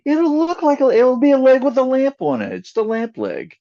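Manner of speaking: silly voice. Acted. Silly joke voice.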